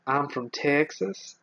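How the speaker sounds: slow, drawn-out attempt at a Texan accent; amateur imitation of a Texan accent. Shown as a demonstration. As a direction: put on an unsure Texan drawl. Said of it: "Can you do an American Texan accent?".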